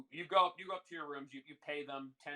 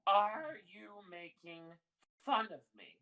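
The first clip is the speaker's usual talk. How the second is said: higher, louder and drawn out, offended; slow, insistent repeat; 6 semitones higher, 5 dB louder than usual. Acted. Old woman challenges customer who denies mocking her, slowly insistent.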